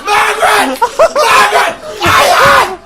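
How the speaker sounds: yelling unhinged boss; boss from hell. Pretend. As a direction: manic screaming office boss. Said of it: "this is my impression of a world where I have a secretary".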